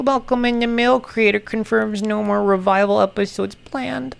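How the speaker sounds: ordinary talk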